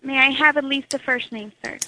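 ordinary delivery

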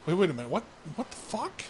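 rising high in disbelief; high mock bewilderment; confused girl. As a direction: play her baffled, climbing up.

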